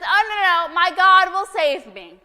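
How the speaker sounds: loud, high, insistent refusal; stubborn flood victim refusing help, loud and high; stubborn believer. Acted. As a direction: cry out no like nothing could move him. Stubborn man refuses rescue.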